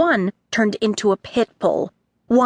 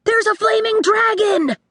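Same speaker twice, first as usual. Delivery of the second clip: put-on boy shouting; 12 semitones higher than usual. A narrator's reading. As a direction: yell the danger. Boy warns of dragon.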